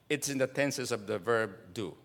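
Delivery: usual speaking voice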